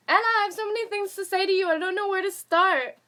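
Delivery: high, fast, excited rush; excited gushing. Real, not acted. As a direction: gush at her breathlessly, half-laughing. Excited reply to fan.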